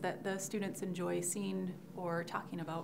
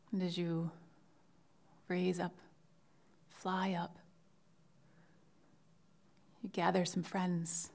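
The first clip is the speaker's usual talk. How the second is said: guided imagery. Shown as a demonstration. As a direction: lead the class gently through it, phrase by phrase. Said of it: "give you an example of that now".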